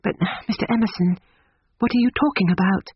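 high, quick, puzzled question; bewildered young woman. A narrator's reading. Lucy bewildered by the old man.

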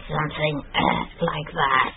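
soft, airy irregular voice; breathy deviant voice. Shown as a demonstration. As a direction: let the voice go breathy. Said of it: "a lot of breathiness".

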